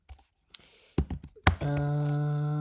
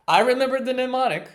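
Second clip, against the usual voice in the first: higher, faster and louder burst of joy; excited triumph; 8 semitones higher, 6 dB louder, faster than usual. Real, not acted. Learner thrilled that the memory trick comes back to her. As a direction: celebrate the sudden memory.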